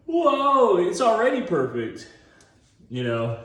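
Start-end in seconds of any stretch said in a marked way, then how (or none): 0.1-2.1 high, loud awed exclamation; hammed-up whoa; mock amazement. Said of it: "And then you're like".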